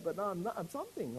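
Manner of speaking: usual speaking voice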